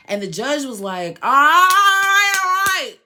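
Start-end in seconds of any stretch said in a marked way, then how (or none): 1.2-2.9 loud, high, drawn-out interruption; booming mock courtroom cutoff; imitated judge's shout. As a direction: yell over them like a fed-up judge. Judge cutting them off, retold.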